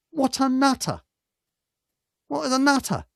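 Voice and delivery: high, disbelieving outburst; high-pitched scoff at the mad policeman; incredulous exclamation. Real, not acted. Exclaiming at the reckless copper.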